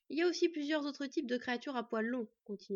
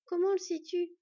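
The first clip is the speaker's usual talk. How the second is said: high, loud worried challenge; urgent questioning; 8 semitones higher, 6 dB louder than usual. A narrator's reading. Anxious girl doubting her friend's reassurance, urgent questioning. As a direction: demand to know, pitched up.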